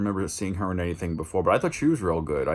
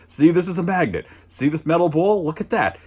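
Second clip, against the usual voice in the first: a high, earnest show-and-tell voice, lightly voiced; mock-patient teacher explaining a toy; explaining a magnet; 9 semitones higher, 4 dB louder than usual. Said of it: "explains the concept of a magnet".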